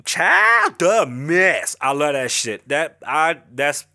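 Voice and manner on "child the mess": gossip gasp. Real, not acted. Fan gushing about a gossip blogger, high drawn-out squeal.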